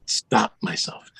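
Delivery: whispered trailing word. Retold or imitated. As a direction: drop to a whisper. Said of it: "I need someone to stop me".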